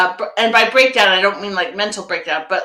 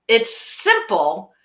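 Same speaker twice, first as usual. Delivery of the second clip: ordinary delivery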